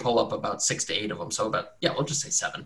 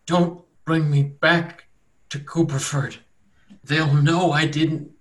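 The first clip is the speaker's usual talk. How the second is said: slow, higher, halting plea; pleading captive; 5 semitones higher, 4 dB louder, slower than usual. Acted. Tortured man on the grass begs rescuers, pleading. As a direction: beg haltingly, voice raised in distress. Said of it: "looking up at you guys with faintness in his eyes".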